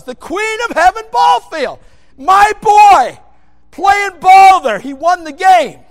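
loud, high full-force sermon shouting; fiery preaching. Acted. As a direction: thunder it from the pulpit.

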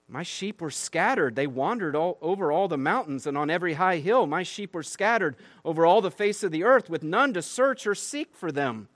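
reading God's rebuke with rising force, high and loud; fervent scripture reading. Acted. Pastor reads Ezekiel's rebuke to the congregation, preaching with force. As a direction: proclaim it like a prophet thundering at the shepherds.

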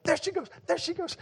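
put-on squealing high child's voice, giddy; excited little girl. Father imitating his excited little daughter at a wedding scene, squealing. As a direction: imitate a thrilled five-year-old daughter. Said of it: "And she was so excited".

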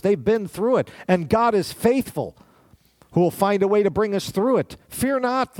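preaching climax. Acted. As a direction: lift it up in fervour.

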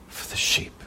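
soft unvoiced whisper. Real, not acted. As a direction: let it fall to a whisper. Whispered end of verse.

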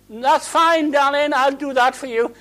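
high, sweet lady voice, hammed-up; mock polite lady. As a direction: play the other driver sweetly giving way. Mock polite reply between drivers.

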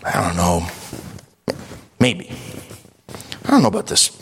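imitated doubter with long pauses; hesitant listener. Imagined churchgoer weighing faith giving, hesitant pondering. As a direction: mull it over, reluctant and unsure. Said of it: "you sit there tonight and you go".

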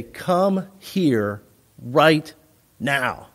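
slow, spaced-out command; firm parent losing patience; stern parental order. Shown as a demonstration. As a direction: lay down each word slowly, with pauses. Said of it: "depending on their response".

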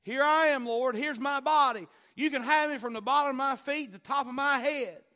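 loud high fervent cry of surrender. Retold or imitated. Preacher voicing a believer's surrender, loud and high. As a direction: lift it up, giving yourself fully to the Lord. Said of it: "You're gonna say".

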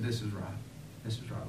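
soft, hushed resignation; quiet acceptance. Acted. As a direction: say it softly, making peace with it. Abraham's quiet acceptance voiced.

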